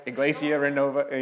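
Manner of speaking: a careful try at a Spanish accent on Iglesia Renova; testing his Spanish pronunciation on Iglesia Renova. Shown as a demonstration. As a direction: say the church name the Spanish way. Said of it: "I don't know if I'm saying that with the Spanish, uh, accent".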